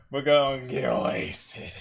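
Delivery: slow, put-on bro voice; party friend voice. Friends inviting her to get wasted, slow put-on bro voice. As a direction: play the hyped party friend, drawn out. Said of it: "sit there and be like".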